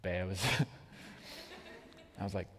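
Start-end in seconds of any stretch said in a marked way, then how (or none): 0.0-0.4 soft, low "Bears" with the r dropped; hushed, solemn toddler pronunciation; really serious child. Retold or imitated. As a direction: imitate a small kid's grave one-word answer. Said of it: "in, like, a really serious tone".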